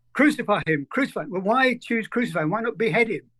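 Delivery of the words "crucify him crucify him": ordinary talk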